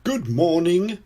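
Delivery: beaming greeting. A narrator's reading. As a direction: greet customers with a big smile. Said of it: "beams Mister Fox".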